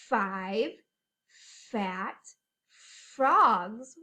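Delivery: "Five", "fat", "frogs" said one by one, "frogs" loud; each f word stressed. Shown as a demonstration. Teacher repeating the f words for kids. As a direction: space out and hit each f word. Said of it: "words that start with f".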